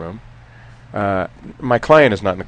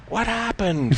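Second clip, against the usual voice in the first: confused client; 12 semitones higher, 5 dB quieter, slower than usual. Retold or imitated. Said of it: "He goes".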